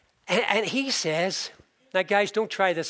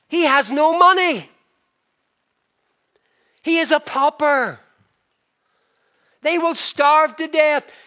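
raised, high and loud, scandalised mother; indignant protest; 8 semitones higher, 6 dB louder than usual. Retold or imitated. Mother outraged at daughter's penniless match, raised and loud. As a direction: cry out that he is a pauper. Wife Goldie protests the engagement.

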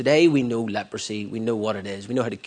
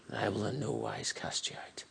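hushed quote; 10 dB quieter than usual. Acted. Quoting Christ's promise softly.

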